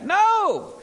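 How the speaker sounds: high, loud shouted reply; hollered answer. Acted. Disciples shout back to Jesus from the boat, loud holler. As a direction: holler it back across the water. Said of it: "They hollered back".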